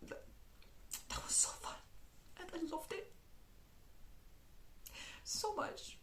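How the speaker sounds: whispered confession. Real, not acted. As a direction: breathe it like a secret. Confiding her love of book.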